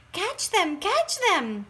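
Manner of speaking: high, rising excited calls; eager cry. A narrator's reading. Children chasing fireflies, crying out eagerly. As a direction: urge them on in the chase. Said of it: "we cried".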